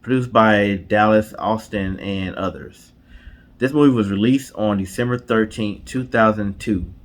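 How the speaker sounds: slow, dramatic reveal read like a trailer; announcer reveal. Acted. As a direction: announce the movie credits with a show host's flourish. Dramatic reveal of movie credits.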